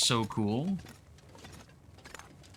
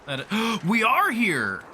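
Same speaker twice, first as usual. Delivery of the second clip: excited gasp; 7 semitones higher, 4 dB louder than usual. Real, not acted. Finding connected caves in game.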